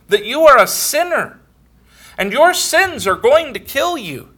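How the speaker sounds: high, loud impassioned preaching; fiery sermon warning; preaching at full force. Acted. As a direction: plead with sinners from the pulpit, rising and forceful. Preacher's plea to sinners.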